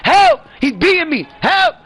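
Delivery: high, loud panicked screaming; mock screams for help. Imitating a beaten girlfriend screaming for help, high and loud. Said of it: "left you screaming for fucking help".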